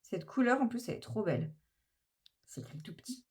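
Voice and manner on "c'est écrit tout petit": very soft whisper, almost voiceless; whispered aside. Real, not acted. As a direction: whisper it low as if to yourself. Aside while reading tiny print. (aparté chuchoté)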